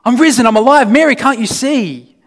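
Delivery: hammed-up jump-out surprise; mock overexcited reveal. Preacher mocks a showy Jesus jumping out at Mary, overexcited. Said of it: "he doesn't jump out at her and say".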